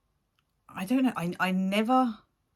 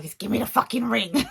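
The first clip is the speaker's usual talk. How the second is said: mock impatient; 7 semitones higher, 7 dB louder than usual. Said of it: "I'm like".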